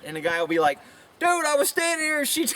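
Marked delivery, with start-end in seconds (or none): 1.2-2.5 high-pitched mock protest; exasperated, raised-pitch imitation; protesting guy. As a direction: plead innocence as the wrongly blamed guy. Acts out the accused guy.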